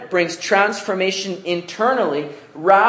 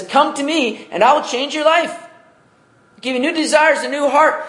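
much higher, pleading invitation from God; inviting God; 10 semitones higher than usual. Retold or imitated. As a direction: call them in gently, high and open. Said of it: "No, he says".

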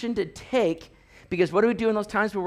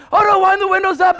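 high, loud mock panic; urgent yelp from an overheated car; comic panic; 12 semitones higher, 10 dB louder than usual. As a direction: cry out in exaggerated alarm. Acted panic in hot car.

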